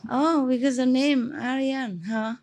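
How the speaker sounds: usual speaking voice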